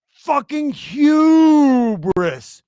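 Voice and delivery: slow, loud, stretched-out yell of disgust; drawn-out shout. Real, not acted. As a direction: drag out the words in a loud shout. Ranting about a streamer's hubris.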